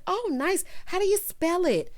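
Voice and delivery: high-pitched mock interest; imitated chatty bride. Bride asking groom about his name, mock perky.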